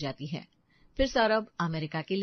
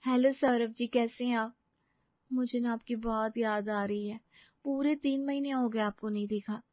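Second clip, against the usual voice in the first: slightly raised, longing wife's voice; longing young wife; 4 semitones higher than usual. Acted. Wife phoning her absent husband, longing. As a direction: miss him gently down the phone.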